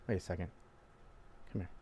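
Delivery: soft, low, drawn-in invitation; low intimate come-on. Shown as a demonstration. Dating advice demo, beckoning the girl softly close. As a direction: pull her in with a hushed voice.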